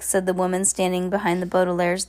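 ordinary delivery, nothing marked

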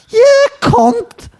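very high mock-Scouse yell; exaggerated Scouse insult; imitated angry Scousers. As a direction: screech it like an angry Scouse commenter. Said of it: "would get really angry were".

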